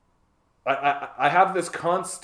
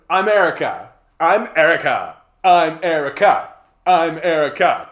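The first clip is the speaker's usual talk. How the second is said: loud, higher, manic repeated announcement; hammed-up self-obsessed chant; psycho party girl; 4 semitones higher, 9 dB louder than usual. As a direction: blurt it over and over, attention-seeking.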